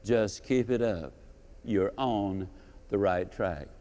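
deadpan it as bitter irony; mock-encouraging, low and drawn out; ironic advice. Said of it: "I would have said".

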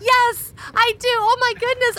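high, loud, overjoyed squeal; ecstatic yes. Acted. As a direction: gush it like she just got engaged. Overjoyed proposal acceptance.

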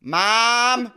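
loud, high Nebraska vowels falling open; Nebraska accent. Shown as a demonstration. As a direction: let the Nebraska vowels fall out wide. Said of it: "mouth opening thing".

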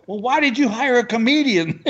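high-pitched incredulous comeback; exasperated. Retold or imitated. Comic to booker who hired him, high exasperation. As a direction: throw the question back, pitch soaring.

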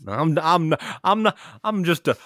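slow folksy Southern lawyer voice. Pretend. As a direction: play a humble country lawyer, hammed up. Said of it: "pantomiming the suspenders".

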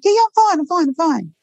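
high-pitched, hurried-sounding mock reassurance; imitated brushing-off. Nurse insisting to colleagues she's okay, imitated high-pitched brush-off. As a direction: imitate someone insisting far too readily.

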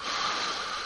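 a soft, unvoiced, slow exhale; deep calming sigh. Shown as a demonstration. Teacher showing the breathing tool to students, soft slow sigh. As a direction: sigh the tension out. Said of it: "a physical tool you can use".

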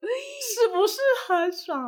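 high, slow "是不是很爽" boast; playful smug bragging. Real, not acted. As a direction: tease the friend with a drawn-out boast. Bragging question.

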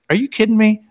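high, astonished question; high disbelief. Retold or imitated. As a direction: pitch up in disbelief. Burke's retold disbelief.

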